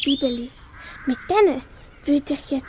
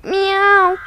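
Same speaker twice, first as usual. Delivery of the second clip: a kitten's startled cry; startled kitten meow; 3 semitones higher, 5 dB louder than usual. Acted. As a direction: meow in alarm. Kitten flees.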